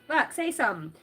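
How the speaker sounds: ordinary delivery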